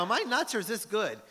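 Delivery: asking your partner, buzzing with excitement; excited. Retold or imitated. Writer calling his co-creator about his daughter's idea, excited.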